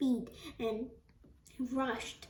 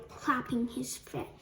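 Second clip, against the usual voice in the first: usual speaking voice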